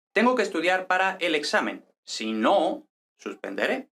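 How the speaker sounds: stressed NO in "Si no", then a break before "suspenderé". Shown as a demonstration. Teacher giving an example of separate "si no", stress on NO. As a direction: set "Si no" apart, leaning on NO. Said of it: "acento prosódico en el no".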